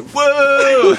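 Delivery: loud, high drawn-out whoa; falling yell. Retold or imitated. Retelling being tripped while running, high hammed-up falling yell. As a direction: yelp it like losing your footing.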